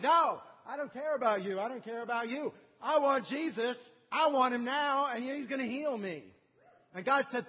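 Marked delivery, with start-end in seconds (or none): 0.0-6.3 loud, high, determined cry; defiant shouting. Acted. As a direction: shout down the crowd. Bartimaeus defies crowd.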